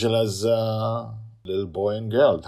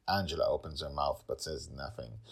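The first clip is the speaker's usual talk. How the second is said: ordinary delivery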